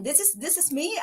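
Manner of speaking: ordinary talk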